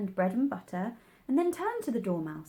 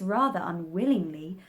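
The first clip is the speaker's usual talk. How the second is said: ordinary delivery, nothing marked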